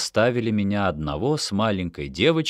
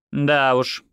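higher-pitched but unbothered reply; indifferent; 6 semitones higher than usual. A narrator's reading. Leras answers Yoni's question, mildly indifferent. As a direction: answer as if it hardly matters. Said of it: "равнодушно ответила она".

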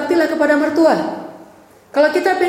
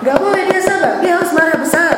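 raised, high, forceful preaching; emphatic rebuke; 5 semitones higher, 4 dB louder than usual. Real, not acted. Preacher rebuking husbands who tolerate infidelity, emphatic. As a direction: lay down the law, pitched up.

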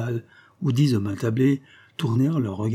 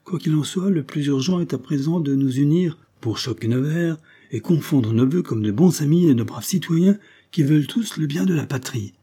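higher, raised toast to the table; rally the drinkers to unite; exclaiming toast; 6 semitones higher, 4 dB louder than usual. Acted. Guest rallies the diners to drink together, exclaiming. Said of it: "s'écria l'un des convives".

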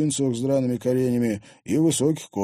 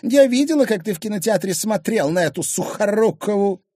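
high, loud indignant accusation; jealous accusation; 12 semitones higher, 6 dB louder than usual. Acted. Wife accusing husband of eyeing another woman, indignant. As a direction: accuse him in a raised voice.

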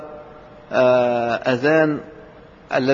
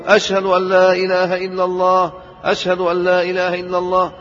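higher, louder ringing call; raised voice; 5 semitones higher, 5 dB louder than usual. Shown as a demonstration. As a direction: lift the voice and project the testimony. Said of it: "بصوت مرتفع".

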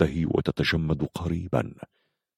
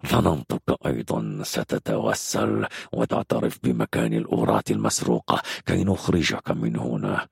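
low villain voice with heavy breath; sinister captain taunting his captive; menacing commander; 5 semitones lower than usual. Acted. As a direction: threaten the prisoner coolly, keeping it low.

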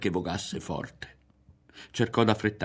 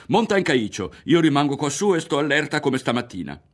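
higher, louder urgent commands; urgent orders; 7 semitones higher, 6 dB louder than usual. A narrator's reading. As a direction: rush the instructions out. Berto giving urgent orders. (ordini concitati e ad alta voce)

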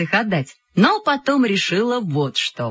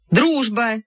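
firm, slightly raised correction; stern repeat; 4 semitones higher than usual. A narrator's reading. Chairman repeats the agenda item to a heckler, sternly. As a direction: repeat it strictly. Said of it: "строго повторил Коля".

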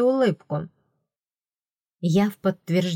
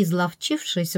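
plain reading voice

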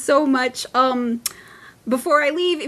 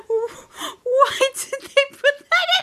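1 baffled; 14 semitones higher, much slower than usual. Retold or imitated. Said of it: "I'm just like".

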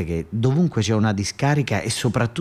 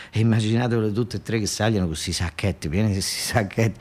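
usual speaking voice

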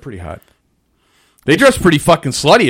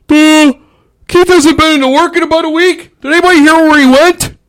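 high, loud mock coworker voice; clueless coworkers; 13 semitones higher, 6 dB louder than usual. Worker imitating coworkers asking where he went, high and loud. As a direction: ask around as a clueless coworker.

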